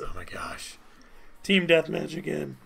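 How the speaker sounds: exasperated groan. Real, not acted. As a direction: grumble it under your breath. Game puts him in Deathmatch.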